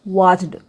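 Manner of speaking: watched mispronounced "wajd", with a voiced d; voiced final D. Shown as a demonstration. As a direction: end watched on a voiced d, as the wrong example. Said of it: "नहीं पढ़ेंगे". (watched के अंत में ड की आवाज़)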